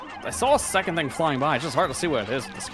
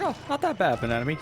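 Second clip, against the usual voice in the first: mock dismissive; 3 semitones higher, faster than usual. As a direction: toss it off like it's nothing.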